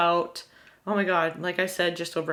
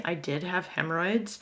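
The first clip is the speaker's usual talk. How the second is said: ordinary delivery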